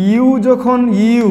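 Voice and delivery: very slow, stretched teacher phrase; drawn-out slow. Shown as a demonstration. Teacher stretching out the U rule for students, very slow. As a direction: stretch each word so it sinks in.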